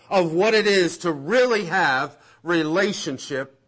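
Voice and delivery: high, forceful pulpit delivery; insistent, punched repetition from the pulpit; impassioned preaching. Acted. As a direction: hammer each item of the list.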